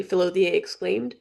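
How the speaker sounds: ordinary delivery, nothing marked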